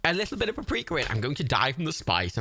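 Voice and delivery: high-pitched mock British panic; hammed-up horror, as if faint at the mildest seasoning; fussy British alarm. Mocking the British fear of paprika, mock-panicked. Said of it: "very British thing to be like".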